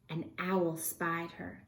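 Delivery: hushed, slow whisper. A narrator's reading. Narrator whispers to the listening child, hushed. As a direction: breathe it like a secret.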